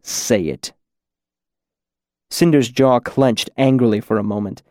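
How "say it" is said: low, cool order; cool command. A narrator's reading. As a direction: order it coldly, pitched low. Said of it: "the cool voice repeated".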